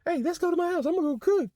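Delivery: high, put-on old lady voice inviting him over. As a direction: play a club auntie coaxing a young man home. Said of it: "She invited me and my girl to her house".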